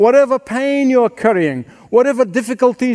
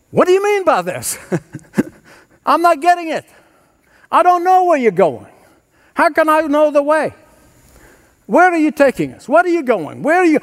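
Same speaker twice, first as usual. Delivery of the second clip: higher, puzzled questioning voice; confused disciple questioning his teacher, voice pitched up; confused Thomas; 6 semitones higher, faster than usual. Retold or imitated. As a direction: play a bewildered disciple asking.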